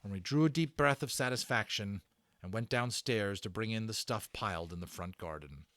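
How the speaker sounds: plain reading voice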